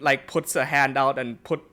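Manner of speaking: ordinary delivery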